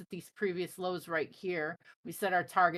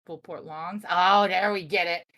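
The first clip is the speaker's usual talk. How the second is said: normal talking voice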